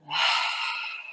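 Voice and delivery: airy, voiceless sigh out the mouth; empty the lungs in one long breath; audible exhale. Shown as a demonstration. Meditation guide breathing out along with the class, audible exhale. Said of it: "exhale out the mouth".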